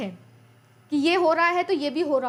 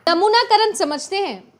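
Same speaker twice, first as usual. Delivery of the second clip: playful quiz; 4 semitones higher, 7 dB louder than usual. Real, not acted. Teacher teasing class with funny word.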